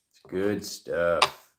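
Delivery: slow, deep-pitched playful echo; low drawn-out repeat. Pretend. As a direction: drop low and stretch every word.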